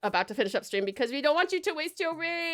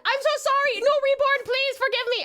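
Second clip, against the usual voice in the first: high, laughing, embarrassed apology; flustered apology; 12 semitones higher, 4 dB louder than usual. Real, not acted. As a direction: squeal out a panicked sorry. Apologising for swearing at raider.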